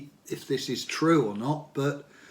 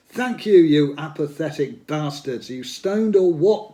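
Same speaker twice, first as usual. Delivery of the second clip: a loud, higher mock taunt at the crowd; 4 semitones higher, 9 dB louder than usual. Singer taunting an apathetic gig crowd, a loud mock jeer. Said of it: "taunts [name]".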